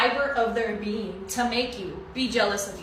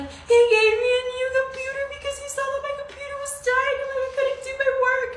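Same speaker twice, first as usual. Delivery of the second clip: high insisting; 4 dB louder, faster than usual. Retold or imitated. Defending the gift to mom.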